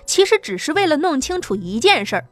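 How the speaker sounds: plain reading voice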